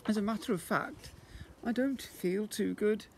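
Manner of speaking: a touch of a weary mother; admitting weakly. A narrator's reading. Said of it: "admitted Missus Large".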